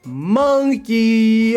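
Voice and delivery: MONKEY belted high and loud; drawn-out punchline. Real, not acted. Streamer repeating his joke for chat, belting MONKEY. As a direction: swoop up and sustain "monkey".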